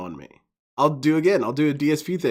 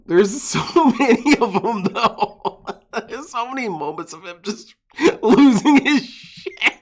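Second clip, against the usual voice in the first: giggling through the words, higher pitched; laughing; 6 semitones higher, 3 dB louder than usual. Real, not acted. Streamer laughing while describing Phil's meltdowns. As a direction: talk while cracking up at the clip.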